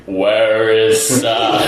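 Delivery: low, dragged-out demon growl; deep demonic voice. Retold or imitated. Friend answering from under the car, deep demonic voice. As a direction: draw it out like a demon. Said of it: "deep demonic voice".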